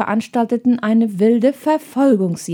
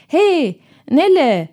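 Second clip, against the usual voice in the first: high, raised call; pitched-up shout after someone; calling after her; 6 semitones higher, 4 dB louder, slower than usual. A narrator's reading. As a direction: call out to stop her leaving. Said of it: "rief er dem Mädchen nach".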